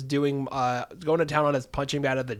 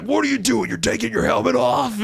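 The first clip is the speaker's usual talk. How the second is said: incredulous coach yell; 8 semitones higher, 6 dB louder than usual. Retold or imitated. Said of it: "He's like".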